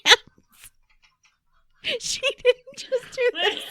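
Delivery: laughing hard, high. Real, not acted. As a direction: crack up while saying it. Laughing fit about karate hands.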